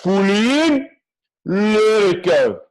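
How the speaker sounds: high, loud, slow breathing call; Wim Hof impression. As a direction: lead the breath like a guru. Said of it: "It's the perfect Wim Hof impression".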